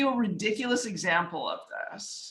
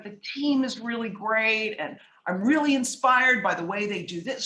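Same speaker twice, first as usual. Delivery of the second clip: ordinary delivery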